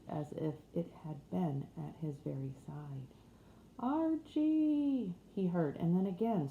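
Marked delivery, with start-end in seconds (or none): 3.8-5.1 much higher, calling from far away; a voice drifting in from afar; distant call. Acted. Dream voice calling to the sleeping man, distant and high. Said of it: "The voice seemed very far away".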